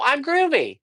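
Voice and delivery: high, bright, beaming; playful feel-good answer; cheerful groovy reply. Retold or imitated. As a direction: sell the word groovy with a grin. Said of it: "And I go".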